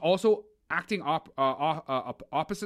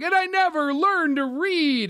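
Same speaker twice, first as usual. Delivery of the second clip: much higher, slow hammed-up tearful line; Wayne's World Oscar moment parody, mock weeping; mock Oscar-moment crying; 10 semitones higher, 4 dB louder, slower than usual. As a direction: overplay a sappy confession for laughs. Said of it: "and he's like".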